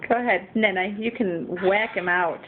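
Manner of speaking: ordinary delivery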